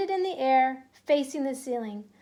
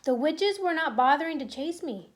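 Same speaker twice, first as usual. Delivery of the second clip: plain reading voice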